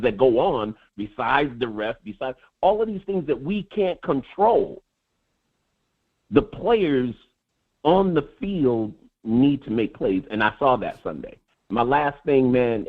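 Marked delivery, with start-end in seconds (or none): none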